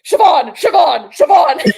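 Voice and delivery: loud, drawn-out calling of a coworker's name; shouting a name. Retold or imitated. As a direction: yell her name across the restaurant, slowly. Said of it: "And I'm screaming".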